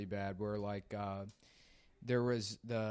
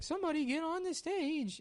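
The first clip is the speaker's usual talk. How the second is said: much higher squeaky call; high-pitched caricature. Retold or imitated. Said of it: "he's like".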